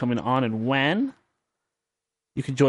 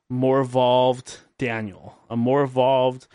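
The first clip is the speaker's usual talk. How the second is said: ordinary talk, nothing marked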